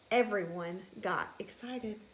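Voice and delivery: ordinary delivery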